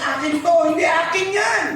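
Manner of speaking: high shouted protest; screaming "hindi akin yan" in a quarrel; quarreling woman. Acted. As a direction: shout it like a mother fighting for her child. Two women quarreling over baby.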